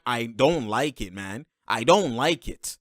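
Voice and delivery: ordinary talk, nothing marked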